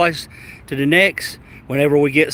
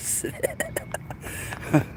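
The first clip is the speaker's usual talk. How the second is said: high breathless laugh; 11 dB quieter than usual. Real, not acted. Laughing at the memory.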